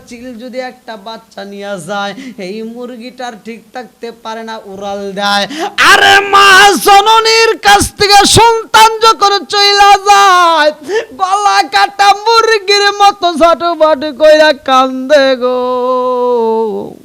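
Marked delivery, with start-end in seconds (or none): 5.9-16.9 very loud, high chanted lament; impassioned sermon cry; full-force preaching wail. A narrator's reading. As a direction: belt it out high, then stretch the last word as it falls. Preacher wails simile at full force.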